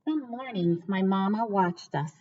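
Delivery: ordinary delivery, nothing marked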